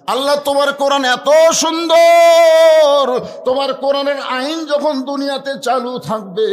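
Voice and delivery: loud, high, long-held cry to God; impassioned high acted prayer. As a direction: wail the praise at full voice. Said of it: "কাঁদতেছে আর বলতেছে".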